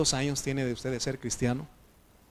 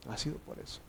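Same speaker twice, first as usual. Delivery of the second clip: whispered, much softer repeat; 3 semitones higher, 14 dB quieter, faster than usual. Real, not acted. Pastor whispers the point again to his congregation, hushed. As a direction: let it sink in with a whisper.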